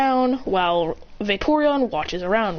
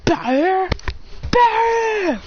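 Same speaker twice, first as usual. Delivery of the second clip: high "bah" bursts; blast noise; 9 semitones higher than usual. Acted. Flamethrower blasting the Morlul, high imitated blasts. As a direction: fire off two high blast sounds.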